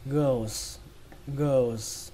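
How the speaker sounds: put-on "girls" as "gơ-s", the "ồ" barely brushed; "girls" with glided vowel. Shown as a demonstration. Teacher models the word for learners, "girls" with a barely heard "ồ" before s. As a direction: skim the "ồ" inside "girls", ending on s. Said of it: "âm ồ chúng ta chỉ lướt qua thôi".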